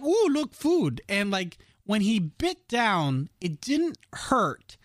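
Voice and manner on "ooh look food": high, eager puppy voice; high excited puppy voice; dog voice. Retold or imitated. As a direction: voice the dog spotting food, delighted. Voicing the dog.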